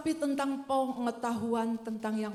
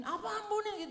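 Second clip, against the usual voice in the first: higher, indignant comeback; snappy retort; 10 semitones higher than usual. Retold or imitated. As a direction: bite back at the advice. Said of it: "lebih galakan dia".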